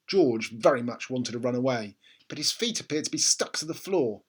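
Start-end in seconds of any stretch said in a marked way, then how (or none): none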